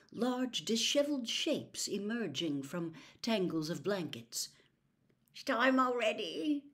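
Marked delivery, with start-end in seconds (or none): 5.3-6.7 a touch of sleepy grogginess, higher; just woken, not yet awake; groggy. A narrator's reading. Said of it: "said Fred groggily".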